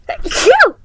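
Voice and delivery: a sudden, sharp, loud sneeze. Real, not acted. Sneeze interrupts reading.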